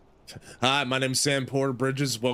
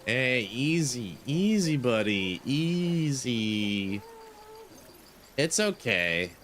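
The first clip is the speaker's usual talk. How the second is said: soothing; much slower than usual. Real, not acted. Calming the crying baby.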